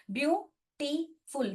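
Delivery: ब्यूटीफुल split into three separate beats, byu-ti-ful; syllable by syllable. Shown as a demonstration. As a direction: break ब्यूटीफुल slowly into its syllables, pausing after each. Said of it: "तीन बार बोल रहे हैं". (ब्यूटीफुल को तीन हिस्सों में तोड़कर, धीरे-धीरे)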